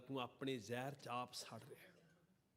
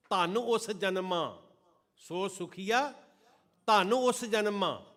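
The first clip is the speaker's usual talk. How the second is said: loud, higher, drawn-out verse; fervent recitation; 5 semitones higher, 11 dB louder, slower than usual. Acted. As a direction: proclaim the lines with fervor.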